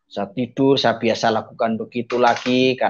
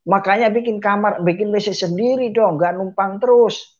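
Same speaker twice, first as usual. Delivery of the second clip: high-pitched mock telling-off; retold irritated complaint, raised pitch; imitated angry scolding; 9 semitones higher than usual. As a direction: scold like a fed-up neighbour, pitched up. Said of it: "marah dia".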